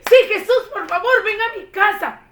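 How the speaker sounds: high, loud, laughing yes; overjoyed to invite him home; excited man accepting Jesus; thrilled. Acted. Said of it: "Saqueo muy emocionado dijo".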